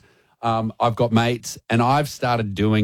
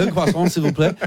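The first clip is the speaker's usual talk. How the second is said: French café order, higher pitch; French accent; 5 semitones higher than usual. Shown as a demonstration. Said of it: "do it how you did it this morning".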